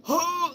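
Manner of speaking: high, theatrical intake of breath; mock gasp. Imitating someone into trauma and drama, mock gasp.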